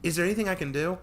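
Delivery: put-on high, eager teen girl voice; keen to make amends. Tori offering help.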